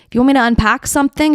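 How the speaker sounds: ordinary talk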